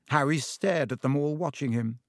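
usual speaking voice

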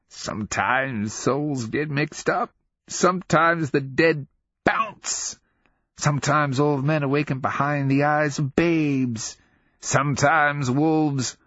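put-on high, drawn-out cryptic old-man musing; mad old man. A narrator's reading. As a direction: relish each riddle slowly.

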